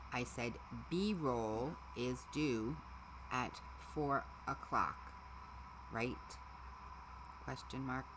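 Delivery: slow, spelled-out dictation to the phone; slow careful dictation. Shown as a demonstration. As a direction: say it slowly so the phone catches each word. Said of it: "That is not what I said".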